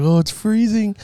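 high mock whine of Oh, it's freezing; mock shivering cousin. Mimicking her cousin complaining about cold, high whine. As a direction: imitate her cousin, lightly whiny. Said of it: "She's like".

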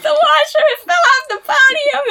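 very high crying shout; retold crying panic. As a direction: wail the news to Dad.

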